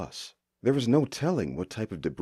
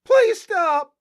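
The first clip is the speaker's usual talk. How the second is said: high, loud desperate screaming plea; desperate shout; 10 dB louder, much slower than usual. A narrator's reading. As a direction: scream it at the oncoming train. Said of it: "She shouted".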